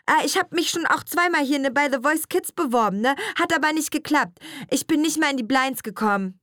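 put-on high-pitched teenage girl rattling on; chatty schoolgirl. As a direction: chatter to teacher like a teen. Girl talks about auditions.

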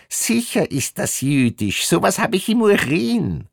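very high put-on voice; piping, self-certain bigot; smug female inspector. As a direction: insist on your hunch in a high pitch. Inspector insists on prejudiced hunch.

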